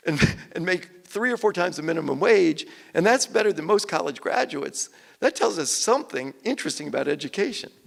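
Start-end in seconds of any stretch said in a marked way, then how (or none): none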